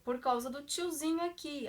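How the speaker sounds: ordinary talk, nothing marked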